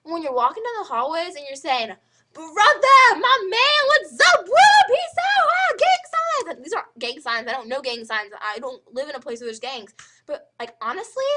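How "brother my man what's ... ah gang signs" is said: very high, loud hollering; exaggerated swagger, shouted and stretched out; mock homie shouting. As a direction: ham up a wannabe gangster greeting friends. Mocking kids in hallways.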